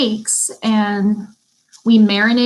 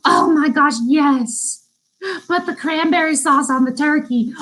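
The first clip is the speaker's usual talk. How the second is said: gushing delight; 4 semitones higher than usual. Retold or imitated. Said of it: "And now I'm like".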